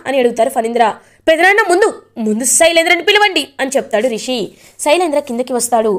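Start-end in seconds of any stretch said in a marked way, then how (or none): 1.3-3.4 urgent demand. Acted. Rishi demands Shailendra be called.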